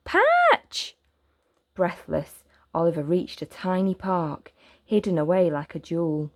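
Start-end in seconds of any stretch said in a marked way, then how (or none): 0.1-1.0 very high, loud call from afar. Acted. Boy calling after his runaway dog. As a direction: yell the name down the street. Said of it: "Oliver called".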